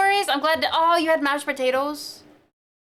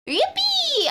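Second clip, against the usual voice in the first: very high squeal of joy; cheer. Real, not acted. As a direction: squeal with delight. Shows animation.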